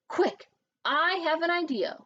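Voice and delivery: ordinary delivery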